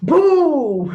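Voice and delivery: loud, high taunting boom; spring the threat with glee; gleeful mock boom. Teacher threatens Malik, loud gleeful boom.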